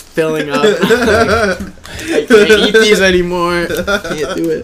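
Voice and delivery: laughing. Real, not acted. Laughing at failing the wings.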